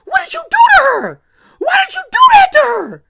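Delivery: shrieking in horror, voice cracking; horrified high scream. Retold or imitated. Sister finding her brother's victim, screaming in horror. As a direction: scream it high and loud, crying out in shock. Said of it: "she's like".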